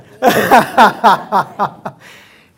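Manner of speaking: very loud, high laughing bursts; big rhythmic guffaw; real belly laugh. Real, not acted. Laughing at own corny pun.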